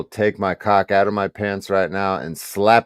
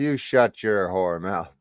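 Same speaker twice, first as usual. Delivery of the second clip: softer and slower, almost purring the insult; taunting his opponent quietly after shouting, soft and slow; 2 semitones lower, 7 dB quieter, slower than usual. Acted. Repeating insult, louder then softer.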